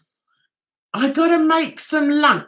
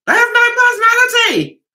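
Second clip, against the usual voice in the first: loud, high, rapid exclamation; exasperated high-pitched shout; emphatic outburst; 12 semitones higher, 6 dB louder, faster than usual. Real, not acted. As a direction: burst out with it, pitched way up. Heated rant about dull panel.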